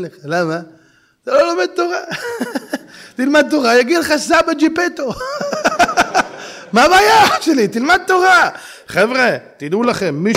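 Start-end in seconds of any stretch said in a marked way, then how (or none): 2.0-2.8 short high giggle; high laugh. Real, not acted. Laughing at own joke. 5.2-6.7 long high burst of laughter. Real, not acted. Laughing at own joke. 6.7-8.5 higher, louder preaching; fervent exhortation. Real, not acted.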